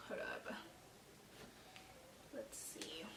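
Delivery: near-whisper, very soft; talking to herself. Real, not acted. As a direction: murmur it absently while looking something up. Muttering to herself while checking messages.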